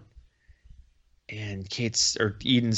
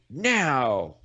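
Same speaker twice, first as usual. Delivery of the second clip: acted command; 4 semitones higher, 3 dB louder than usual. Acted command as arrows fire.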